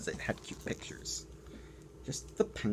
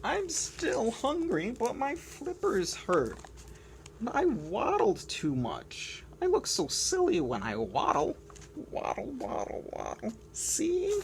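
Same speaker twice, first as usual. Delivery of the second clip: higher whiny penguin voice; complaining penguin; 13 semitones higher, 4 dB louder than usual. Acted. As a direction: sulk about waddling. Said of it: "Doing my complaining voice hurts".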